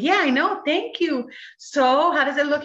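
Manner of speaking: lightly hammed-up eager patient; mock impatient. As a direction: wave off the news, already knowing. Already knew, asks doctor.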